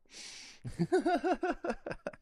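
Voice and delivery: squealing laugh burst; high-pitched laugh. Real, not acted. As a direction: let out a shrill giggle. Laugh after allergy admission.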